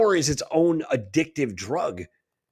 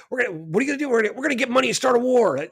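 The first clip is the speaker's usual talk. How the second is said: higher, fast cartoonish gloat; mock villain boast; 7 semitones higher, much faster than usual. Mocking the elites to a friend, fast gloating villain voice. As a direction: rattle it off like a smug cartoon villain.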